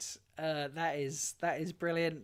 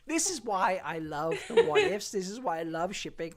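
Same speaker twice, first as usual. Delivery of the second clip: ordinary talk, nothing marked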